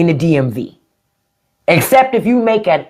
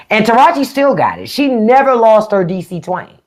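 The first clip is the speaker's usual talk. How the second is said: usual speaking voice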